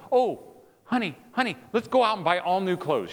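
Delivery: high, mock-eager offer; over-eager husband. Husband offering pregnant wife new clothes, over-eager. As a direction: gush it like a clueless fixer. Husband overdoing a wrong fix.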